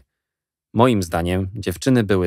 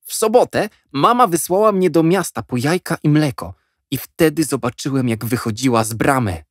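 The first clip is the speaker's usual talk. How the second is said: put-on eager boy; 7 semitones higher than usual. A narrator's reading. Jack tells his story.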